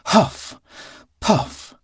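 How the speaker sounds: puffing breaths while running; panting out huff and puff; breathy huff puff. A narrator's reading. Cat running to castle.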